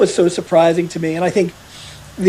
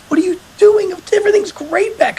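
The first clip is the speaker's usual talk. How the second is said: much higher, mock disbelief; incredulous friend; 13 semitones higher than usual. Friend from home visiting the hospital, mock incredulous and high. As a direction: pitch it way up like a stunned buddy. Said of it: "visits him and says".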